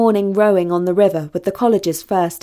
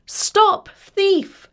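high-pitched cry of alarm; urgent, much higher-pitched call; 11 semitones higher than usual. A narrator's reading. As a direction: call out after a thief. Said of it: "called out Talia".

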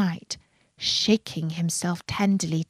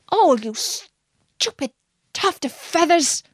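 angry scolding; 8 semitones higher than usual. A narrator's reading. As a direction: snap at the silly bird, cross and impatient. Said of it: "said Rikki Tikki angrily".

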